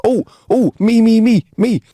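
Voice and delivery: high, loud, bouncing; desperate to be picked; eager kid. Acted. Little Johnny begs the teacher to pick him, high and loud.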